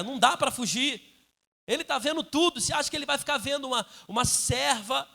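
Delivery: loud, driving sermon; the pastor thunders at the fathers and husbands, loud and driving; preaching at full force. Acted. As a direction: preach it with fire.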